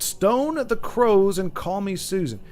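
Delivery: slow, hammed-up Australian accent. Streamer reading a chat phrase in a mock Australian accent. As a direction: drawl it out in a bad Australian accent. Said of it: "my amazing Australian accent".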